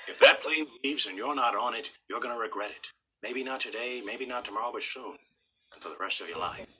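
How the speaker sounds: low-key, soft movie tough-guy impression; Humphrey Bogart. Tenth-grade school play role, quiet Bogart impression. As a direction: drop to a hushed old-film leading man murmur. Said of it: "I was doing Humphrey Bogart".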